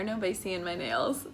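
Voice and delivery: laughing through the words; amused self-mocking laugh; giggling about her nails. Real, not acted. Laughs at herself.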